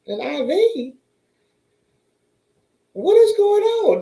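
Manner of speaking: high, loud and slow panic; rising panic at the news; shocked cry of what is going on; alarmed disbelief. Retold or imitated. Alarm at being given IV.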